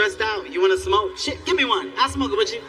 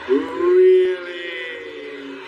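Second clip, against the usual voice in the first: mocking disbelief; 3 semitones lower, much slower than usual. His lady's friend calling out his excuse, sung skeptical. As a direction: stretch it out like you don't buy it.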